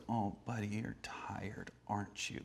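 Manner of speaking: hushed, nearly whispered sympathy; soft soothing. Retold or imitated. Heidi talking to the demonized snake man, hushed soothing. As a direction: coo it very softly, as to a child.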